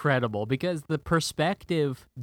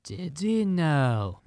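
slow, drawn-out villain voice; Davy Jones impression; 3 semitones higher, much slower than usual. Podcaster answering the hero's cue, slow villain impression. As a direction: stretch each word out like a movie sea villain. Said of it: "I think I can do in his voice".

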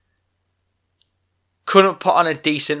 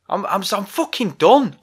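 fed-up outburst; 6 semitones higher than usual. Real, not acted. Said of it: "vented a frustration".

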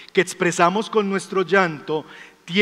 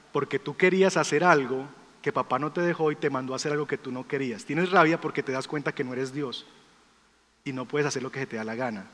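low, soft fatherly explanation; quiet explaining; 4 semitones lower, 6 dB quieter than usual. Retold or imitated. Father telling his angry little son the reason, quiet explaining. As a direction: lay it out quietly to a child.

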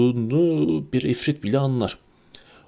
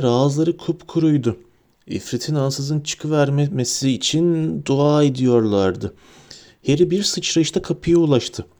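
ordinary delivery

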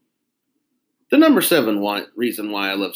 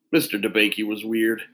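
plain reading voice

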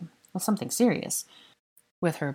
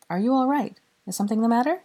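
a touch higher, gently concerned; lightly voiced young woman; 7 semitones higher, 3 dB louder, faster than usual. A narrator's reading.

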